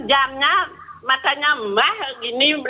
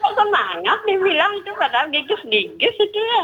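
put-on voice pitched up a little; teasing disguised caller; 7 semitones higher than usual. Caller bantering with the host while munching, playfully disguised voice. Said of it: "tak rubah maunya tadi karena mulut berisi singkong".

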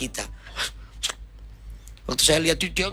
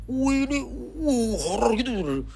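high, drawn-out mock "whoa" of horror; horrified awe; 8 semitones higher, slower than usual. Preacher recalls seeing the wrecked room, horrified awe. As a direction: draw out the wows, raised pitch.

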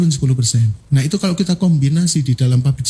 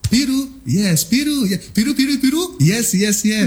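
higher, quick game-caller voice; ice-breaker leader calling out, pitched up; 6 semitones higher than usual. Shown as a demonstration. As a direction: call colors to keep the crowd alert. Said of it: "Merah contoh".

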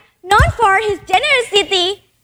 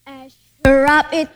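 usual speaking voice

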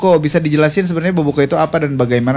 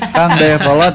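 reads the name through a laugh; laughing while reading out the greeting; 3 semitones higher, 3 dB louder than usual. Real, not acted. (sambil tertawa)

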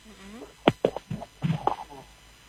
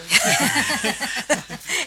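light laugh; 6 semitones higher than usual. Real, not acted. Host laughs at own remark.

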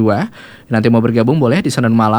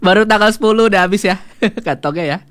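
laughing; 8 semitones higher than usual. Real, not acted. Joking about empty pockets.

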